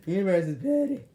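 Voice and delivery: laughing. Real, not acted. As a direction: let the laugh break into it. Repeats line through laughter.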